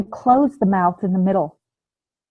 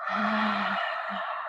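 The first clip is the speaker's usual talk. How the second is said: unvoiced exhale rasped in the back of the throat; throat breath sigh; 12 dB quieter than usual. Shown as a demonstration. Teacher shows where the breath should be felt, throaty sigh. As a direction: push the breath out through the throat, not the nose. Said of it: "It's that feeling in the back of the throat".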